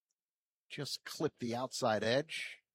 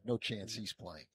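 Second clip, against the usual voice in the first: normal talking voice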